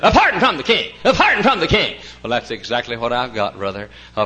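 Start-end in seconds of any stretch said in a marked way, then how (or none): 0.0-2.0 high, loud theatrical proclamation; operetta performer. Retold or imitated. Operetta man in knickers announcing a pardon, mock theatrical cry. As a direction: imitate a stage herald, each repeat the same tune. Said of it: "went down in front and washed up and said".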